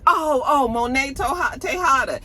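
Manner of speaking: high, flustered realization; flustered recognition. Retold or imitated. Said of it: "he was like".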